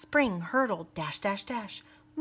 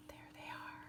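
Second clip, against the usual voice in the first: whisper; 25 dB quieter than usual. Real, not acted. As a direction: whisper as if pointing them out. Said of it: "aside to listeners".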